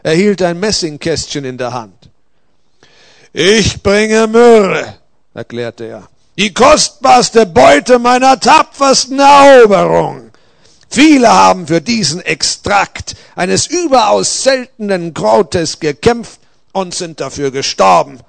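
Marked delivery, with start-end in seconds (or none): 3.3-5.0 loud, high, drawn-out proclamation; slow booming declaration; proud conqueror. A narrator's reading. Soldier proudly declares his gift. 6.4-18.2 loud, high, grand proclamation; forceful boast, easing slightly toward the end; boastful conqueror Balthasar. A narrator's reading.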